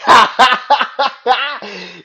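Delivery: big, high, loud laugh of disbelief; loud exasperated cackle; loud disbelieving laugh. Real, not acted. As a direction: burst out laughing at how mad you are. Laughing at own anger.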